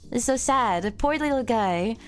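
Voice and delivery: usual speaking voice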